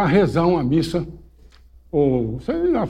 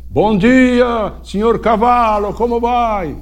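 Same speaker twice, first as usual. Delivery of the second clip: saudação cerimoniosa; 6 semitones higher, 4 dB louder, much slower than usual. Retold or imitated. Padre cumprimenta o cavalo.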